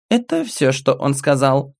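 put-on high, bright child voice; little girl voice. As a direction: speak as a small girl telling her story. Little girl character speaking.

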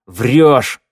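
loud one-word accusation; angry bark. A narrator's reading. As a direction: bark it right at him. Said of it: "рявкнул Теодор". (рявкнуть)

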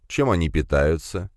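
usual speaking voice

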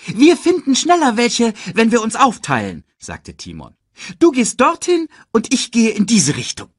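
high, loud, bossy cartoon sidekick; Timon. A narrator's reading. Timon tells Pumba to split up, high and bossy.

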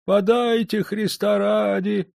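put-on old beggar's voice, high and slow; beggar's plea. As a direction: plead for alms, stretching it out. Blind beggar begging again. (нищий старик протяжно просит милостыню)

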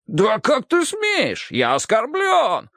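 high, slow, hammed-up offense; mock outrage. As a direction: play wounded pride for laughs. (театрально оскорблённо)

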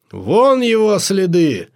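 high, drawn-out call to the hunting party; excited hunter spotting tracks. A narrator's reading. Pointing out the beast's tracks.

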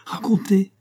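high-pitched urging cry; eager exclamation. A narrator's reading. Choirmaster urging the enthusiast to tell his story, eager exclamation. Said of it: "s'écria le maître de chapelle".

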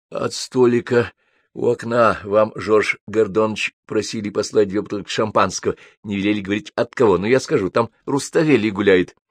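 ordinary delivery, nothing marked

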